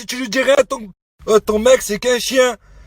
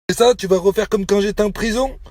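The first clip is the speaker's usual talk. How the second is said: ordinary talk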